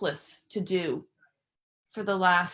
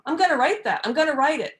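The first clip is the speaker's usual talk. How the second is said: retold eagerness; 6 semitones higher, 5 dB louder, faster than usual. Retells her sudden poem idea.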